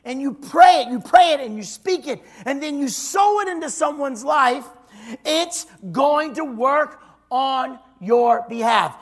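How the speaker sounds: slow, lifted preacher's cadence; preacher sowing the word over the congregation, slow and lifted; preaching. Acted. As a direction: draw out each phrase like a promise from the pulpit. Preacher opens with a declaration.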